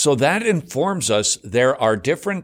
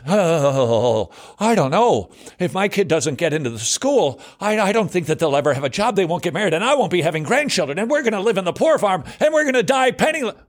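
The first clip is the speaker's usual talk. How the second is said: rising faster and higher into panic; panicky worry; 7 semitones higher than usual. Acted. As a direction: spiral from worried to frantic. Acted anxious parent spiraling.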